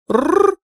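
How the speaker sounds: high rolled growl; dragon growl. A narrator's reading. Heroine moving a dragon figurine, growling. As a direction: trill a growl for a toy dragon. Toy dragon pouncing on paper balls.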